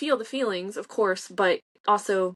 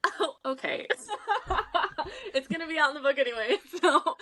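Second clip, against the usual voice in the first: laughing; 8 semitones higher than usual. Real, not acted. Laughs nervously at dating question.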